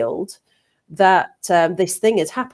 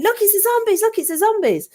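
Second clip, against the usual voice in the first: high, excited pointing cry; thrilled children calling out; excited kids; 14 semitones higher, faster than usual. Retold or imitated. Kids excitedly showing each other the zombies in the field. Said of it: "getting more and more excited".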